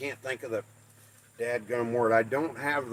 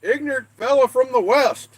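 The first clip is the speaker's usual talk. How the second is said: mock family voice; 9 semitones higher, 4 dB louder than usual. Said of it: "They're like".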